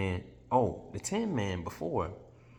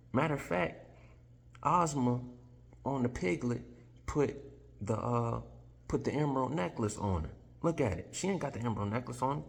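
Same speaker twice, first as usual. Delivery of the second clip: cat revealing truth; 5 semitones higher than usual. Retold or imitated.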